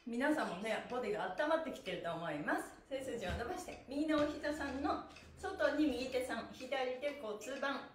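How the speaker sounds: usual speaking voice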